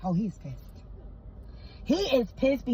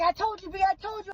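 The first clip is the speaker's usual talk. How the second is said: gloating told you; 8 semitones higher than usual. Retold or imitated. Husband gloating after vindication.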